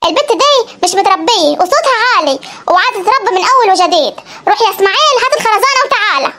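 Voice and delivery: a granny giving orders; bossy grandmother. Acted. Grandmother ordering grandfather to fetch a diaper, low and bossy.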